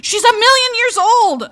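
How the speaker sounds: loud, high exasperated rant; shrill-free loud outburst at a character. Real, not acted. Ranting at a hated cat.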